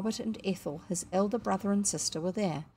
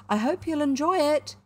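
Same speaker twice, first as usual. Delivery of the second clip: high, louder cheery send-off; cheerful call; 8 semitones higher, 6 dB louder than usual. A narrator's reading. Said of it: "she called cheerfully".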